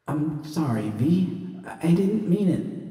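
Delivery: a quiet, tender sorry; soft apology. A narrator's reading. Judy apologizes to V too late, soft and tender.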